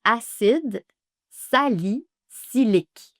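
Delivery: "Acide" then slow "salicylique"; syllable by syllable. Shown as a demonstration. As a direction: pause then stretch "salicylique". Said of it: "Ou l'acide salicylique".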